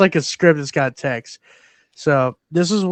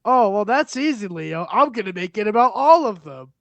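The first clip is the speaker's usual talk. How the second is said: higher mock Scorsese voice; Scorsese impression; 6 semitones higher than usual. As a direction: answer breezily like an old director. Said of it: "And Martin goes".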